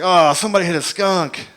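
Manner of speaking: imitating a buddy hit by a stink; grossed out. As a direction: recoil at a foul smell. Buddy reacting to skunk smell.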